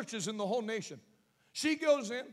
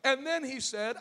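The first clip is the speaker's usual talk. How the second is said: ordinary delivery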